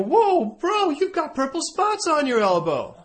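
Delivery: whoa, high and loud, shocked; mock startled exclamation. Preacher spotting Micah's elbow, mock startled exclamation. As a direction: catch sight of the spots and jump.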